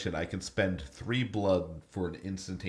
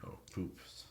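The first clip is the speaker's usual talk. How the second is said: whispered; 14 dB quieter than usual. Real, not acted. Bad roll, muttered to self.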